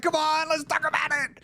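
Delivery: very high, fast, giddy tempter voice; giddy inner voice. Pretend. Tempting inner voice urging him to tell everybody, giddy high. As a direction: squeal like an overexcited inner child.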